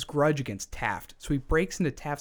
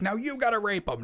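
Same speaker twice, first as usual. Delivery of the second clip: Carl ordering; 9 semitones higher than usual. Retold or imitated. Carl ordering his accomplice.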